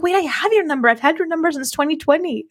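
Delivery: surprised mom. Retold or imitated. Mom finding the number already saved, surprised. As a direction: re-enact a mom's surprise.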